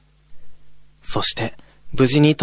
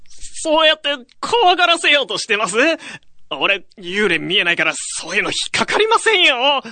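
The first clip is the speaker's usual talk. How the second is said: put-on casual young guy; 11 semitones higher than usual. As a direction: shrug it off as a student. Young man character. (軽い後輩口調)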